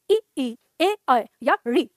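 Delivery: each of "ई, ई, ए, ऐ या री" pronounced separately for students; vowel demonstration. Shown as a demonstration. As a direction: sound out "ई, ई, ए, ऐ या री" one by one. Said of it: "जब हम उच्चारण करते हैं इसका". ("ई, ई, ए, ऐ या री" का अलग-अलग उच्चारण)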